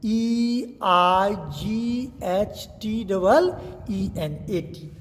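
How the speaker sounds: eighteen spelled very slowly, one letter at a time; slow spelling. Shown as a demonstration. Teacher spells eighteen slowly for students to write. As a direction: dictate each letter, then say the word. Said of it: "जैसे eighteen आप कैसे लिखते हो".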